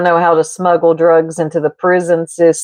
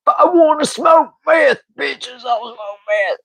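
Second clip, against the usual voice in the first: higher shouted, then dropping off; soundboard shout; 5 semitones higher than usual. Retold or imitated. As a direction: yell it like the old soundboard clip. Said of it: "That's old Ink".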